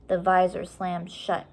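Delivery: ordinary delivery, nothing marked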